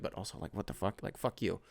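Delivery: hushed private grumble; under breath. Retold or imitated. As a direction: mutter it so he can't hear. Muttered aside to himself.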